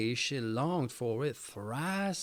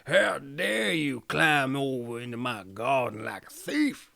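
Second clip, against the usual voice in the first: angry witch. A narrator's reading. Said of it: "she cried with angry eyes".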